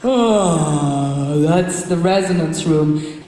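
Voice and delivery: slow yawning sigh. Shown as a demonstration. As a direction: speak through a yawn. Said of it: "Everybody yawn".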